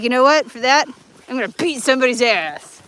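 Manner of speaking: hammed-up angry threat, voiced for a dog; mock pissed-off dog. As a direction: play the dog's indignant outburst. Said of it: "Oh, now he's pissed".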